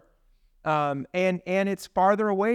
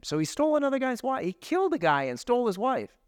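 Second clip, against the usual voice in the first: indignant; 8 semitones higher than usual. Real, not acted. Indignant retelling of David's crime.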